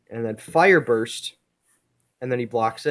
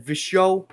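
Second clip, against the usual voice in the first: higher, louder whooshed sound effect; portal opening sound; 7 semitones higher, 5 dB louder than usual. A narrator's reading. Comic narrator imitates a portal opening, mock sound effect. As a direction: voice a portal bursting open. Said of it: "That's the sound of a portal being opened".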